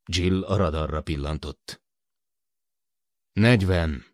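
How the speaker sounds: usual speaking voice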